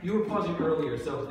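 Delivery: usual speaking voice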